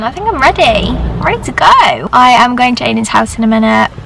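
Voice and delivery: normal talking voice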